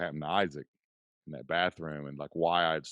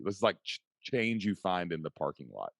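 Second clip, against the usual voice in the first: ordinary delivery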